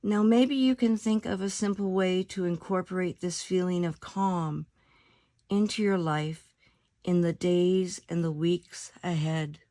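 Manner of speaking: calm meditation voice with long pauses between phrases; guided meditation. Acted. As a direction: lead them unhurriedly into stillness, letting each phrase settle.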